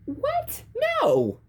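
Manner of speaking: very high squeaky protest; shocked geek. A narrator's reading. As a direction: squeal it like a startled nerd. The geek reacts.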